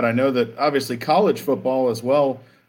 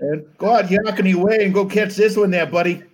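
playful mock Boston accent, higher; 6 semitones higher than usual. Co-host joins the bit in a mock Boston accent. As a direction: lay on a Boston accent for fun. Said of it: "Boston accent?".

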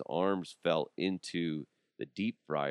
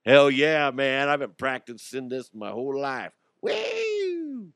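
hyped shout; 4 semitones higher, 4 dB louder than usual. Pretend. Acting out the helicopter dance.

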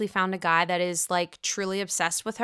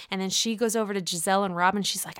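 usual speaking voice

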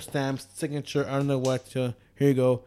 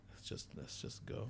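very soft, almost whispered; hushed, just wanting to leave; drained murmur; 3 semitones lower, 22 dB quieter than usual. Retold or imitated. Imitating himself telling his friends to leave, drained hush.

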